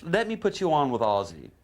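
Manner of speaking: Sharon Auden. Retold or imitated. Manager handing the phone over, imitated female voice. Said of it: "she goes".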